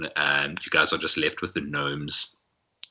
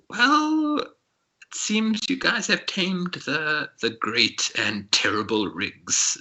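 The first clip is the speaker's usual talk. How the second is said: high, slow teasing gnome voice; amused gnome; 12 semitones higher, much slower than usual. Acted. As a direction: drawl it out, mocking the big guy.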